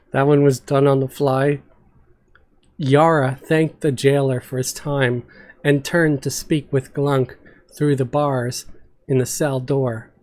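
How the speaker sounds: ordinary delivery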